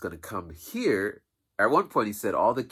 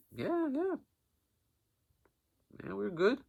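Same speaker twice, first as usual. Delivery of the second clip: much higher put-on reassurance; mock reassurance; 5 dB quieter than usual. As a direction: squeak that all is fine. Reassuring the teacher.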